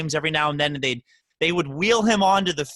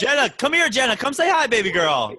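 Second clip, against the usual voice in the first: higher, louder pet call; calling the dog; 9 semitones higher, 4 dB louder than usual. Real, not acted. Host calling the dog Jenna over to say hi. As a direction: coax her over, bright and loud.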